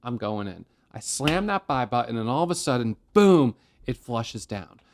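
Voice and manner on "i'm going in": low, muttered under the breath; muttered impulsive quote. Retold or imitated. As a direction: mutter it like giving in to frustration. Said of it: "I'm feeling frustrated".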